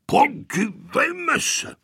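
high, drawn-out indignant exclamation; angry, aloud. A narrator's reading. Grandfather scolding the children, indignant. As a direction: play the cross grandfather. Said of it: "zlobil se dědeček nahlas".

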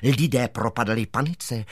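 ordinary delivery, nothing marked

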